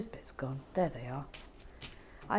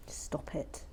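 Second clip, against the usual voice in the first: a whispered stop it; soft, breathy echo of stop it; 3 semitones lower, 9 dB quieter than usual. Real, not acted. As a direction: say it softly under the breath.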